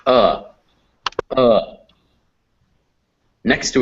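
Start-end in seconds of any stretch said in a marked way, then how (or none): 0.1-1.8 "uh" short and isolated; uh vowel. Shown as a demonstration. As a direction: sound out "uh" as a separate short vowel, pausing between. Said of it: "this is uh".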